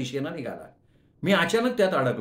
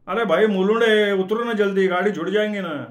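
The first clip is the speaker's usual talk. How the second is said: much higher and louder, hurrying him; urgent shout; 6 semitones higher, 6 dB louder than usual. Acted. Passengers urge the narrator to jump off at Mulund, loud and urgent. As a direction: shout to get off before the train goes.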